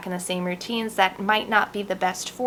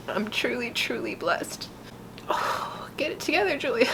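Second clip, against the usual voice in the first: soft, tearful, pulling yourself together; real tears, voice softened; crying; 3 semitones higher, 5 dB quieter than usual. Real, not acted. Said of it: "I've never cried during a tarot card".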